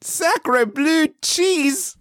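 high, slow mock French cry; french chef. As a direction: draw out a hammy Sacre bleu.

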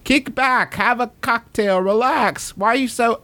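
high, dreamy travel-ad pitch; Jamaica ad voice. Retold or imitated. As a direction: sell the island getaway. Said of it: "It's like those commercials for Jamaica".

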